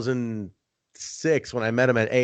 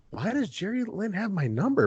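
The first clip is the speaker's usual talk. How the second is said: baffled; 9 semitones higher, 4 dB quieter than usual. Retold or imitated. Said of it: "And I'm like".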